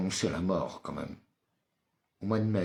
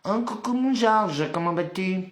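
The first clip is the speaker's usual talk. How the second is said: excited greeting; 9 semitones higher, 4 dB louder than usual. Real, not acted. Greeting a viewer in chat.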